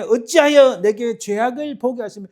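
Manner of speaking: loud, high cry of why; angry outcry. Acted. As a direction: call out to God in protest. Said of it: "분노가 치밀어 일어나서 막 외치는 거예요".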